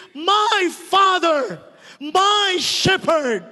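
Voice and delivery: slow, loud, heartfelt calling to God; fervent prayer address. Shown as a demonstration. Preacher showing the congregation how to call on God, slow and fervent. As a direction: call out to God as a loving Father. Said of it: "address Him".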